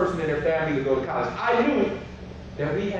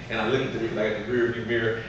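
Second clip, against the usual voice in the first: ordinary delivery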